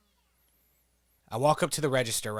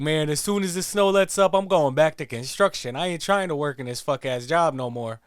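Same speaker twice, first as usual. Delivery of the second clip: higher, louder worker voice; the cashier griping about his job, higher and louder; imitating the worker; 4 semitones higher, 6 dB louder than usual. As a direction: vow to quit, a fed-up worker. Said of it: "And he's like".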